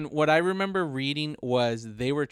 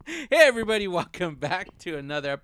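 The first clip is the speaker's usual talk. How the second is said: bright, loud podcast welcome; excited intro; 4 semitones higher, 4 dB louder, faster than usual. Shown as a demonstration. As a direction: hype the show opening. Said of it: "oh, I already introed".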